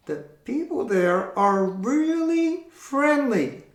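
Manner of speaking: high squeaky puppet reply. Acted. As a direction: voice the little puppet. Puppet voice, slow.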